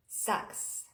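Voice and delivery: hushed, blunt whisper on sucks. Real, not acted. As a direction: whisper the word sucks, breathy and soft. Blunt honest aside to viewers.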